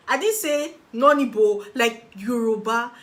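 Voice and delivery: emphatic. Real, not acted. Stressing all roommates were Yoruba.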